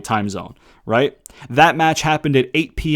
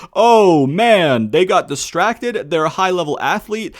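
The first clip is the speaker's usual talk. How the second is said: higher-pitched, exaggerated complaint; mock dismay; 5 semitones higher than usual. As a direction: imitate an over-the-top outraged fan. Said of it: "those people who are like".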